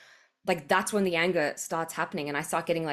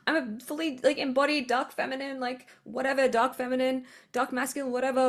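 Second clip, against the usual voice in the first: pitched higher, mock self-important poser; mocking imitation; 6 semitones higher than usual. Speaker mocks people claiming dark feminine embodiment, sneering imitation. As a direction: lampoon someone bragging they're embodied. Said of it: "pretending to be".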